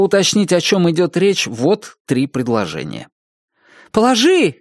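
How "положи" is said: high, sharp exclamation; cried out. A narrator's reading. Narrator voicing a woman's order, cried out. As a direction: cry it out, pitched well up. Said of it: "крикнула она".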